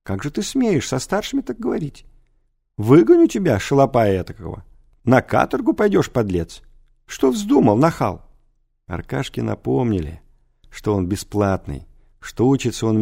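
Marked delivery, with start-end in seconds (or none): 0.0-8.3 scolding director. A narrator's reading. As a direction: berate the pupil hotly. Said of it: "отчитывал директор".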